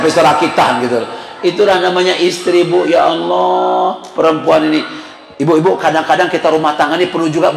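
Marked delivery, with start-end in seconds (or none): 2.8-4.8 long drawn-out "Ya Allah"; slow, sustained sigh of exasperation; exasperated lament. Real, not acted. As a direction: stretch it out in comic despair. Exasperated lament about wives.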